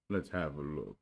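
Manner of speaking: resigned, mournful offer; lightly voiced glum donkey; gloomy Eeyore.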